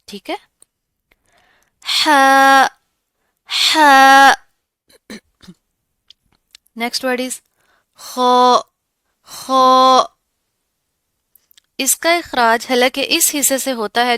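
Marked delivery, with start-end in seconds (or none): 2.1-4.3 ہا from the mid throat, loud and clear; soft thin ha. Shown as a demonstration. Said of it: "اس کی آواز دیکھتے ہیں". 8.0-10.0 خو with a full mouth, loud; heavy kho. Shown as a demonstration. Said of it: "heavy آواز کے ساتھ آپ نے اس کو پروناؤنس کرنا ہے".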